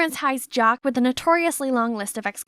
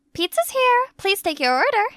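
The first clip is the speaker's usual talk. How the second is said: much higher, bright fake-cheerful voice; sing-song pizza delivery voice; high sweet delivery voice; 8 semitones higher, 3 dB louder than usual. [name] poses as delivery.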